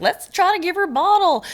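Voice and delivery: high, chirpy nurse voice; mock cheery nurse instruction; imitated nurse. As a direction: pitch it way up as a perky nurse.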